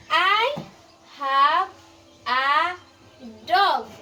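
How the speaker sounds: each word spaced out slowly; slow school dictation. Shown as a demonstration. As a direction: read word by word for a child. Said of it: "I will read it again".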